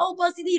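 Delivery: "opacity" opening on a long OH, as a wrong reading; unlikely reading of opacity. Shown as a demonstration. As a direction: demonstrate the mistaken OH-puh-si-tee for "opacity". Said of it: "chắc chắn là không ai đọc là".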